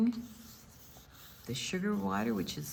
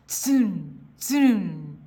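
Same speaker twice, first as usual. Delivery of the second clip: louder, buzzing imitation of wings; wing hum; 7 dB louder than usual. Shown as a demonstration. Reading about hummingbirds to kids, a buzzing wing-beat imitation. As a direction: make the whirr of tiny wings.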